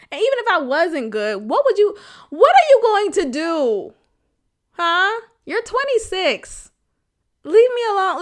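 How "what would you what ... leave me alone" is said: high, loud, exasperated rebuke; acted-out fed-up outburst, pitched high; exasperated scolding. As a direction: tell him off with rising exasperation. Imagined scolding of the man texting her.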